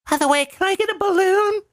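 high-pitched imitation child voice; sweet little-kid request, put on; mock little girl. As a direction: pipe up high like a kid asking a favour. Acted child asking for balloon.

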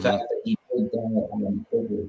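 muffled, far from the mic; underwater. Real, not acted. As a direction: sounds like talking underwater. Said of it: "You sound like you're underwater".

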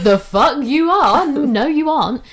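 hammed-up outrage at his cheek; mock outraged retort. Retelling telling him off.

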